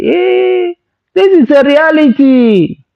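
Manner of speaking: high, slow, drawn-out scolding; exasperated reality check. Retold or imitated. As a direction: spell it out to her like she's lost her mind. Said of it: "I say".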